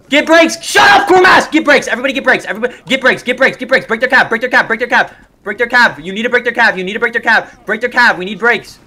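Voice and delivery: high, loud, frantic yelled orders; raging shout. Real, not acted. Team leader raging at players to get breaks, frantic shouting. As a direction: scream commands at the team in a real rage.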